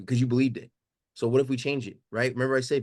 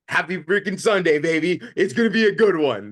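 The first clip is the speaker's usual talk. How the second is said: louder, higher mock-cheerful shout; forced cheer; 5 semitones higher, 7 dB louder than usual. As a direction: grin through the disaster and sell it. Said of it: "I was like".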